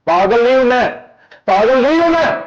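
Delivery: high-pitched heated protest, repeated; angry denial. Acted. Denies being mad in a quarrel.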